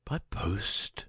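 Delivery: weak echoing whisper; faint whisper. Acted. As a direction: whisper it back, barely there. Dying man echoing weakly.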